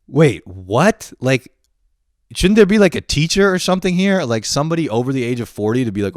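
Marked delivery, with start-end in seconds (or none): 0.1-4.2 loud, rising bafflement; alarmed disbelief. Retold or imitated. New student looking for a teacher, alarmed disbelief. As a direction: demand to know where the grown-ups are. Baffled by peers being in charge.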